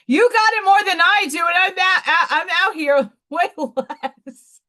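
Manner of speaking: loud, high, laughing exclamation; real laughter breaking into loud words; laughing protest. Real, not acted. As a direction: burst out laughing as you protest.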